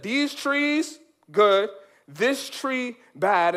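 slow, drawn-out declaration; lightly voiced solemn God; God's decree. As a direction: spell the rule out slowly. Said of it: "God once said".

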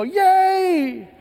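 high, loud, drawn-out cheer; kids' cheer. Retold or imitated. First graders greet Superman's arrival, cheering. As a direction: imitate a whole class cheering at the top of the voice.

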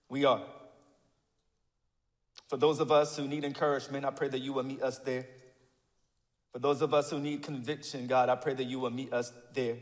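low, soft praying voice; pastoral prayer. Real, not acted. Pastor praying for God to meet the congregation, hushed prayer. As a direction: pray quietly and evenly, well below preaching pitch.

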